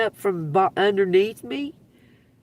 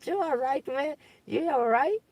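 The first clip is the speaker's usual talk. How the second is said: concerned McDonald's lady; 8 semitones higher, 5 dB quieter than usual. Retold or imitated. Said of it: "comes over and says".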